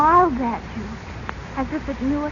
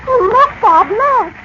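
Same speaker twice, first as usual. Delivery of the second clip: higher and louder, thrilled alarm; excited cry; 5 semitones higher, 7 dB louder than usual. Acted. Girl calls her brother to see the turning statue, excited and loud. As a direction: call out as the statue moves. Daughter sees statue moving.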